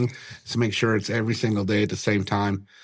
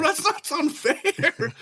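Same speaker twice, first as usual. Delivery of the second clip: high slow mock nonchalance; slower than usual. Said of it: "I'm going".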